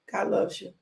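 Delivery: usual speaking voice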